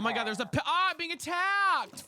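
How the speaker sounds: real alarm as the attack hits; startled panic. Real, not acted. Attacked by mob in game.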